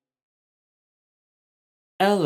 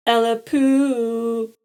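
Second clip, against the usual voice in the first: slow, high, louder giggly Ella poo; giggly baby dragon; 7 semitones higher, 5 dB louder, slower than usual. A narrator's reading. Rascal teasing Ella with new words, giggly. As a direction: tease in a sing-song baby voice. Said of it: "Rascal giggled".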